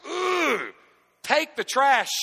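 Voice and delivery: a high, loud "ugh" of disgust, then a snapped order; disgusted groan. Acted. Preacher acting out his disgust at a stinking trash can, loud and high. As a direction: recoil from the stink and bark the order.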